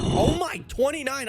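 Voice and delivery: high, loud cry of disbelief; shocked outburst. Real, not acted. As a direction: burst out in shock at the offer. Outraged at a villager's offer.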